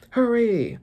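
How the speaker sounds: a hint of an arrogant command; Celestial Dragon. Celestial Dragon urging Marines, mildly haughty. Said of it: "talking to some Marines".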